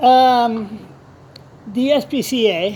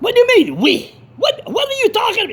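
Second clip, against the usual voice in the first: high, agitated retort; indignant outburst; 12 semitones higher, faster than usual. Retold or imitated. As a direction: fire back high in disbelief. Acted argument with the caller.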